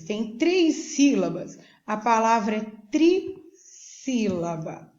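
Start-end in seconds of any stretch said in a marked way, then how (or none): 2.9-4.9 "trissílaba" slowed, with a break after "tri"; syllable by syllable. Shown as a demonstration. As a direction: say "trissílaba" in drawn-out, separate parts. Teacher splitting the term in recap.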